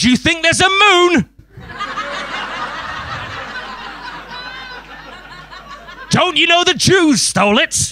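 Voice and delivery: high, slow, giggling mock madman; out-crazy them. Comic out-crazies a conspiracy theorist, high and slow with giggles. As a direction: ham it up as a wide-eyed lunatic. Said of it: "just out-crazy them".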